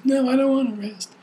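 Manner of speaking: put-on high, loud whiny little girl; child's protest voice. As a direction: pout it like a sleepy toddler. Father voices daughter's protest.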